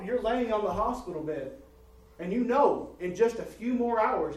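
high, loud pulpit preaching; preaching at full force. Acted. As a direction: drive it home to the congregation.

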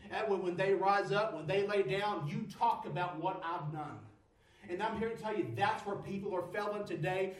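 ordinary delivery

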